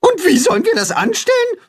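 high, fast, worried shout; high, hurried animal voice; anxious cry out. A narrator's reading. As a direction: blurt it out in a panic. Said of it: "Alle rufen durcheinander".

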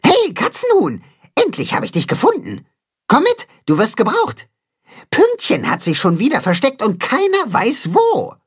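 high excited little helper calling out; high storybook caller. A narrator's reading. Caller fetches Katzenhuhn, high and eager.

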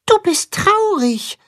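hoch und langsam, zart wie ein kleiner Maulwurf; behutsam, fast zerbrechlich sprechen; zarte Stimme. Acted. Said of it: "mit zarter Stimme". (Maulwurf Max spricht mit zarter, hoher Stimme)

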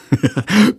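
high giggle; boy can't stop laughing; giggling laugh. Acted. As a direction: burst out giggling. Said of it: "hält sich den Bauch vor Lachen".

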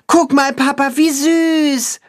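put-on very high delighted child voice; boy calling out. A narrator's reading. A boy shows his father Pünktchen, calling out. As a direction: call out high, as a little boy. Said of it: "ruft der Junge seinem Vater zu".

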